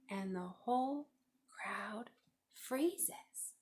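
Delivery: plain reading voice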